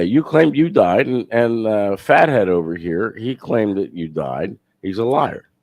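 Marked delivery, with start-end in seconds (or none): none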